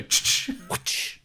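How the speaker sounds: two sharp gun-cocking clicks; imitated gun click. Podcaster mocking Doctor Who's gun effects, mouthed clicks. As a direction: mimic a gun cocking by itself. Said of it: "it would still go".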